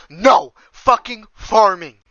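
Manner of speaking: loud, high, slow yell; furious shout. Real, not acted. Gamer cursing the endless farming, furious yell. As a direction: explode at the grind.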